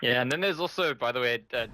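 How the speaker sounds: ordinary delivery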